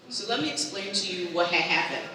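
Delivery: normal talking voice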